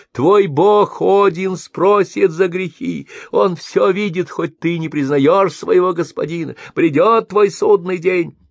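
merchant's warning. A narrator's reading. Merchant warns Svenel'd that Odin sees all, warning. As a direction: warn of the god's judgment.

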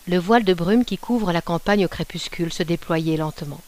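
plain reading voice

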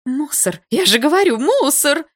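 high, loud abusive shout; furious, high-pitched bawling; yelling. A narrator's reading. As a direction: yell the insult in vindication. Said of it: "заорала Есина".

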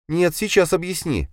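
put-on high, sharp irritated girl; insist, annoyed; irritated demand. A narrator's reading. Катя irritably demands Dima explain now. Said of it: "потребовала Катя раздражённо".

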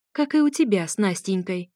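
usual speaking voice

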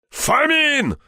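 high-pitched drawn shout of a name; yelling. A narrator's reading. Степаныч calls his partner on the roof, yelling. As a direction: holler for your partner. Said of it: "заорал Степаныч".

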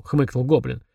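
ordinary delivery, nothing marked

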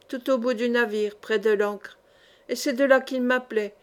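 plain reading voice

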